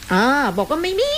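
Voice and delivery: a light mock high voice; mock cutesy voice. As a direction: lift into a teasing cutesy tone to mock the denial. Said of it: "ทำไมต้องทำเสียงสองบุรีรัตน์".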